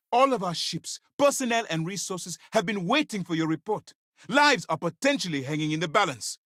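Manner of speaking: high, urgent dressing-down; angry reprimand. Acted. Commander berates agent over delayed report.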